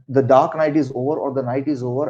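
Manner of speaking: usual speaking voice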